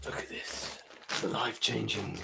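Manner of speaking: whispered, slow mock documentary narration; hushed commentary. Streamer narrating a live shirt change, whispered. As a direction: whisper it as if unveiling a ceremony.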